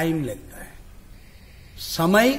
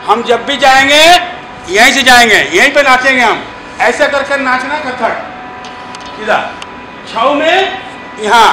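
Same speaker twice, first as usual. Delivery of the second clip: louder, commanding dance-teacher voice; loud class instruction; 6 semitones higher, 8 dB louder than usual. Real, not acted. Chhau teacher instructing students on sword and stance, loud and commanding. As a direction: project to the whole hall as you correct them.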